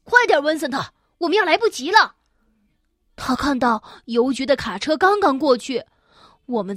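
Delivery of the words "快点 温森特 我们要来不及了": loud hurried call; urgent shouting. A narrator's reading. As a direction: shout while running off. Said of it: "巴尔纳贝一边嚷着". (边跑边大声喊)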